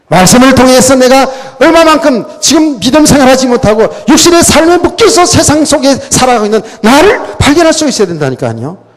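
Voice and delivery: high, loud urgent exhortation; forceful preaching. Real, not acted. Preacher urges believers to see themselves through the Word, forceful preaching.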